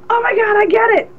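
put-on the kid who finally gets the joke; retold giddy realization; cracking up. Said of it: "I'm cracking up going".

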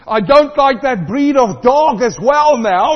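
loud, high, slow whiny complaint; mock sulky young man. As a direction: whine like a jealous teenager.

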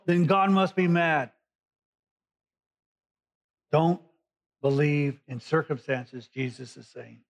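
ordinary talk

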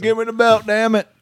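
high, loud mock yell; shouting. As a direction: yell it like a wrestler. Mock ringside belt demand.

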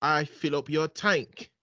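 low, slow mock British man; man's British accent. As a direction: read it like a smug English gentleman. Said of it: "I'm gonna do a man and a woman accent".

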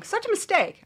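ordinary delivery

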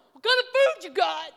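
high, loud mock bark; hungry guys at the door. Preacher mimicking late-night visitors, loud high mock voice. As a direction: boom it like a hungry crowd barging in.

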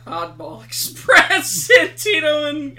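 laughing while reading names; amused laugh through the words. Real, not acted.

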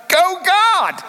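playful high cheer. Retold or imitated. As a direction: cheer God on, hammed up. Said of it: "You're like".

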